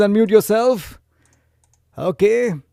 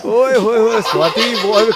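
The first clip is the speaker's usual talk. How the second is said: laughing; 4 semitones higher, 5 dB louder, much faster than usual. Real, not acted. Laughing while calling Swati.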